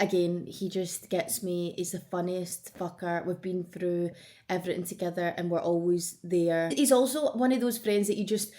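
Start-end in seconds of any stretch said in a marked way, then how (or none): none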